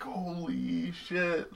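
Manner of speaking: slow, stunned exclamation; drawn out in disbelief; amazed. Retold or imitated. Amazed at farmer's market.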